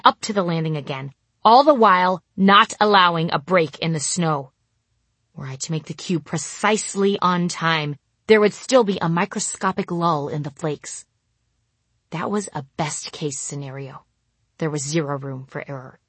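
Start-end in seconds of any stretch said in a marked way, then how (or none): none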